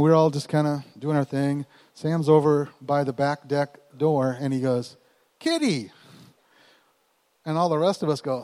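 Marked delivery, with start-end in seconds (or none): 5.4-5.9 high sing-song call of kitty; calling the cat. Retold or imitated. Teen son calls out for the lost cat. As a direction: call the name out toward the door.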